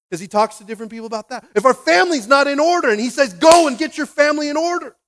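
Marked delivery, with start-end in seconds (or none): 1.5-4.9 loud, high-pitched command; full-force preaching. Real, not acted. Preacher ordering families to get in order, forceful shout. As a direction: proclaim it at full volume.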